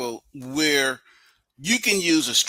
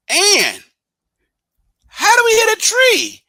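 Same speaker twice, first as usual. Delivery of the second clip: mock disbelief; 7 dB louder than usual. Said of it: "And I say".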